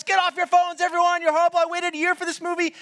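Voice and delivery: high, frantic imitation rant; yelling and screaming. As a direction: scream at the whole audience in outrage. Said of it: "He's yelling and screaming at us".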